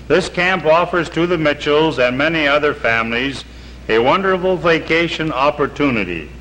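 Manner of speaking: normal talking voice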